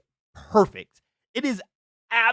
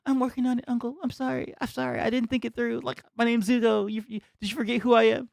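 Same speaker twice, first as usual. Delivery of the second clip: imitating Zuko, high, sheepish and deflated; mock-apologise like a sulky prince caught out; a hammed-up deflated Zuko, pitched high; 6 semitones higher than usual. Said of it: "He deflates and goes".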